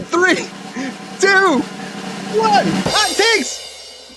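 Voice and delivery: high, hammed-up jingle call; announcer shout. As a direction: announce the segment like a game show. Show jingle intro.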